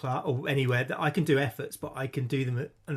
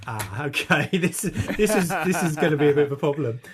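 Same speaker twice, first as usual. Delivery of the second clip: louder, laughing uh-oh; dismayed realization; 2 semitones higher, 6 dB louder than usual. Retold or imitated. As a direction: realize you're in trouble. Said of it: "I was like".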